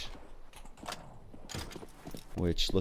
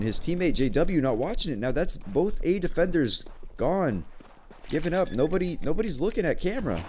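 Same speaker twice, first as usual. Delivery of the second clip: excited play-by-play; 6 semitones higher than usual. Acted. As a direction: pitch climbing higher, fast and urgent. Live play-by-play rising to a peak.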